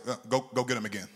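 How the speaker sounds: a hint of God, low and quick; lightly voiced God, quick and low; God's quick reply. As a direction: fire it back like an unbothered father. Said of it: "God said".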